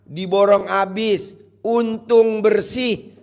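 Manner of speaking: loud, hammed-up sermon voice; mock preaching.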